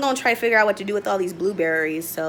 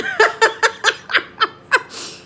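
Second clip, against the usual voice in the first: high, loud laughing fit; helpless laugh; 12 semitones higher, 5 dB louder than usual. Real, not acted. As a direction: laugh high and hard at yourself. Laughing at failed bake.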